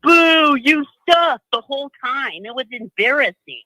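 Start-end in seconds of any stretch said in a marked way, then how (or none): 0.0-1.4 loud, higher-pitched jeer; booing crowd. Retold or imitated. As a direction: heckle like an angry home crowd. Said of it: "all you would hear is".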